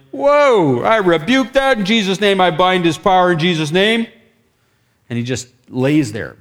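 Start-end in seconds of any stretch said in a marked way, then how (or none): 0.1-4.1 loud, high urgent command; commanding rebuke. Retold or imitated. Minister binding the power over a screaming man, urgent rebuke. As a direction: call out forcefully over screaming, retelling it.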